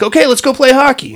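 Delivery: mock chipper. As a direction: toss it off brightly, as if nothing happened. Rich guy cheerfully ignores the warning.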